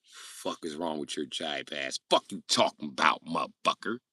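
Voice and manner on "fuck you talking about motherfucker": ordinary talk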